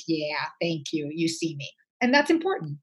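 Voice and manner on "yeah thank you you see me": softer, slow and grateful; a quiet, moved thank-you; hushed, touched appreciation; grateful nod. Retold or imitated. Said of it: "a virtual nod of someone going like".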